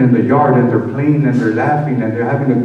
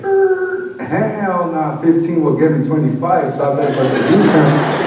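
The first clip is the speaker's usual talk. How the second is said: laughing refusal; 5 semitones higher, 4 dB louder, slower than usual. Retold or imitated. Retold refusal to a fifteen-year-old.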